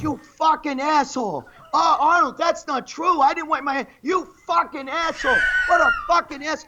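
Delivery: high-pitched mock fury and protest; imitated angry outburst. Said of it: "the guy got so mad at me".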